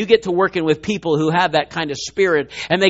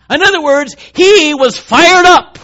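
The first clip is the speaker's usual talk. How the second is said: fired up stretched, louder and slow; emphatic punchline; 9 semitones higher, 7 dB louder than usual. Real, not acted. Preacher explaining the Hebrew word, slow and loud. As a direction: punch it home slowly.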